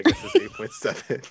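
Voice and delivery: giggling through the words; laughing. Real, not acted. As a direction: laugh while saying it. Laugh heard on line.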